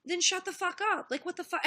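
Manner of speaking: retold mock outburst, pitched high; playful banter. Streamer quoting Bering bantering with his friends, playfully exasperated. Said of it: "not in a mean way".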